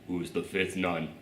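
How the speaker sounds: hushed nun question. Retold or imitated. Voicing the nun character.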